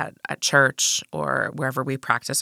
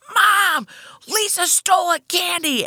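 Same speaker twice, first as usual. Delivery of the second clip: tattletale shout; slower than usual. Retold or imitated. Brother tattling to Mom from the back seat, high tattletale shout. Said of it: "And he was like".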